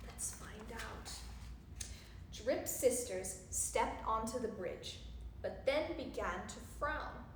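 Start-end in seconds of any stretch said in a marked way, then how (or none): 0.1-1.1 hushed, conspiratorial whisper; very soft storyteller's aside; suspenseful whisper. Acted. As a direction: whisper it to draw the child in.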